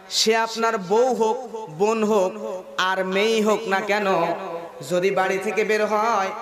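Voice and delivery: ordinary delivery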